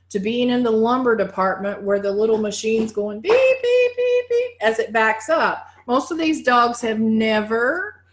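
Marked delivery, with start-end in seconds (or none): 3.3-4.5 high-pitched imitated reverse beeps; machine beeping. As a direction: chirp it like a reversing forklift. Imitates backing-up machine beep.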